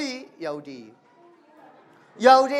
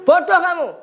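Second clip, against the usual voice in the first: high and louder jeer; mock insult; 8 semitones higher, 6 dB louder than usual. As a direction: shout the name-calling. Acted insult in lesson example.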